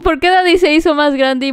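high, loud shocked questions with a laugh; baffled and amused at once; surprised. Real, not acted. Streamer asks Mommy in shocked surprise.

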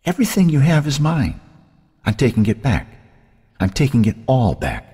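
otherworldly possessive menace; taunting sister. A narrator's reading. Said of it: "Cynthia had told her last night".

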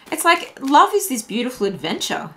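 ordinary talk, nothing marked

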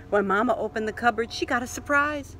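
ordinary delivery

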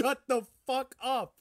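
high, loud, outraged yell; loud mock outrage. Real, not acted. Host yells at cohost for praising Minions, loud outrage. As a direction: shout him down in disbelief.

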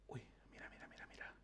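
hushed whisper so nothing hears; whisper, eyes on the trap. Real, not acted. Gamer watching the creature near the trap, whispering.

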